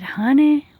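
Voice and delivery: drawn-out Southern "Honey", high and loud; a wise old Southern lady calling "Honey"; Southern belle "Honey"; southern accent. Retold or imitated. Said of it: "in a little bit of a southern accent".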